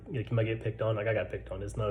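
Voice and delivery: ordinary talk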